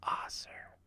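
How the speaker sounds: hushed unvoiced reveal of the name; whisper. Imam reading out the final winner, whispered. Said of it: "It says".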